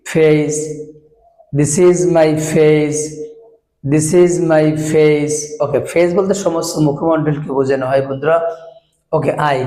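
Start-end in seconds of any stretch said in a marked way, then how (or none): 1.6-5.4 put-on very slow, each word spaced for children; teacher drill for kids, word by word; slow teaching drill. Shown as a demonstration. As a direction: model the sentence slowly for young learners. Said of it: "আমরা this ব্যবহার করে আমরা শেখাতে চাই".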